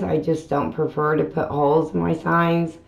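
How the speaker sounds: ordinary talk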